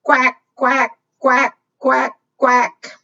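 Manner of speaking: evenly spaced quacks; duck quacking. Shown as a demonstration. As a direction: quack steadily for children to join in. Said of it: "Can you quack with me?".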